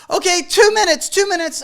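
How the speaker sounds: high, squeaky bargaining voice; mock photographer.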